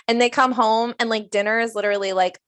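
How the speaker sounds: ordinary talk, nothing marked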